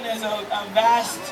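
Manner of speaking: ordinary talk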